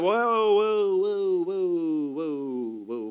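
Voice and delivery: drawn-out whoas, high and loud sinking to normal; winding-down protest. Retold or imitated. Son halting dad's blame, protest winding down. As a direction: start as a cry and slide steadily down.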